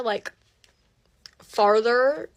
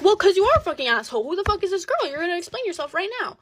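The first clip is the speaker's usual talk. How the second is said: angry confrontation; 7 semitones higher, faster than usual. Retold or imitated. Girlfriend demands boyfriend explain the other girl, angry confrontation. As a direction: reenact laying into him indignantly. Acted quarrel with cheating boyfriend.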